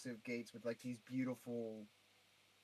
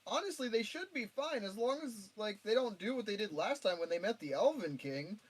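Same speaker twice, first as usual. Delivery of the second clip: mock mom voice; 8 semitones higher than usual. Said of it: "I was like".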